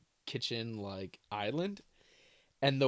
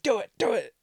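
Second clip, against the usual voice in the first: higher, louder urging; imitating mom egging him on in panic; urgent mom; 10 semitones higher, 8 dB louder, faster than usual. Imitating mom urging her son to turn the knob.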